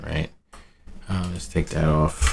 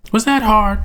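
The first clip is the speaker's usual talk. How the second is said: very high, squeaky mocking voice; ICT impression; 5 dB louder than usual. Imitating ICT's teasing catchphrase in a high squeak. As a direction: jump way up into a teasing falsetto. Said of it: "like I C T, um, always says".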